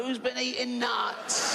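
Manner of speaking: high, slow, drawn-out call; mock accusing outcry. Comic feigning innocence after the lady dies, indignant outcry.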